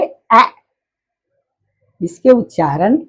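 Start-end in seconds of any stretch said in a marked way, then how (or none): 0.0-0.4 ए said as a short open 'ae', as in cat; short, open ए for the letter A; letter sound. Shown as a demonstration. Teacher gives pupils the sound of A, short open ए. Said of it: "इसके उच्चारण होंगे".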